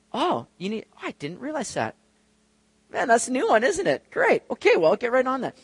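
high-pitched feigned astonishment; exaggerated 'that's news to me'; mock surprise. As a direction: play-act learning something brand new. Mock God learning of a need.

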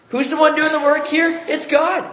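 high, excited rhetorical question and answer. Real, not acted. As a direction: ask it high and lift the answer.

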